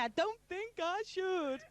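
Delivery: slow, high little voice for the punchline. Acted. Pub joke, woman gives the last hair its timid little punchline voice. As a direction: draw the punchline out sweetly.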